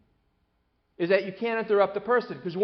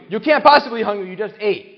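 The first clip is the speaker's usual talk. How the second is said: put-on fast, louder exasperated parent brushing off the kid; dismissive parent; 3 semitones higher, 8 dB louder than usual. Parent to hungry child: mock exasperated dismissal. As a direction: scoff it off like a busy parent.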